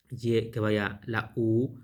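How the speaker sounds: normal talking voice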